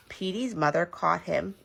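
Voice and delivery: plain reading voice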